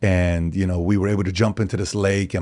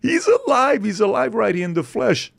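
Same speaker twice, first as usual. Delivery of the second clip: mock excited kids; 12 semitones higher, slower than usual. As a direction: shout it like a kid who can't believe his eyes. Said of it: "crying, saying".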